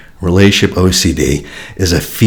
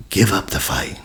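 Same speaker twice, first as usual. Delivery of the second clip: much softer, gentle plea; soft repeat; 8 dB quieter than usual. Shown as a demonstration. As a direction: let it fall quietly, like letting go.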